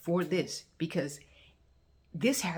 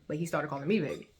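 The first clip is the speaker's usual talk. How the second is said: ordinary delivery, nothing marked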